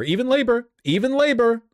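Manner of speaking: very high, loud squeal of disbelief; loud, incredulous high-pitched outburst; amazed exclamation. Real, not acted. Amazed at poll result, exclaims twice.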